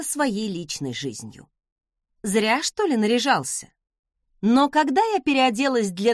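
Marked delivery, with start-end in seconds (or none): none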